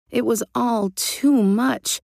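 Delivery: usual speaking voice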